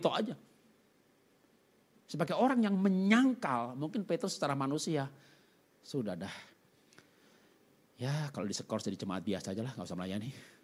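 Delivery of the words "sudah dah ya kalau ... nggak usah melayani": low, soft, defeated mumble to oneself; lightly acted dejection, sinking low; resigned. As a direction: give up quietly, as if disqualified. Voices Peter giving up.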